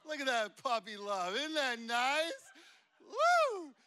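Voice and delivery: high, loud, gushing tease; a hammed-up squeal over puppy love; teasing gush. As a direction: rib the young couple, loudly. Teasing the couple.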